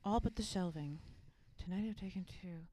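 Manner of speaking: society girl impression. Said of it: "in your best society girl impression".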